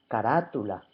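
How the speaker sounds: "Carátula" with the second syllable stressed; stress on antepenultimate syllable. Shown as a demonstration. Teacher giving an example of esdrújulas, stress on "rá" in "Carátula". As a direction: lean on the "rá" in "Carátula". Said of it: "la sílaba tónica es la antepenúltima".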